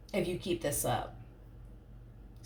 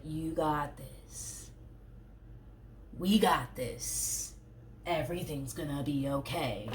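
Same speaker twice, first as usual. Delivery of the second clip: "You got this" said softer and very slow; gentle soft reassurance; 6 dB quieter, slower than usual. Retold or imitated. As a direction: soothe them slowly and softly. Partner voiced gently.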